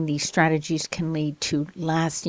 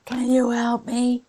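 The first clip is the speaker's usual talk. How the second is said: higher, a put-on pleading learner; voiced learner asking; 7 semitones higher than usual. Learner begging the teacher for help, higher. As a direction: ask like a hopeful student.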